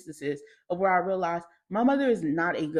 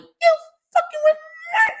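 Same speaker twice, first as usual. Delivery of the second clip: much higher, louder screaming through tears; crying shout; 7 dB louder than usual. Retold or imitated. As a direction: yell at mom with a cracking voice. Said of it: "I was like".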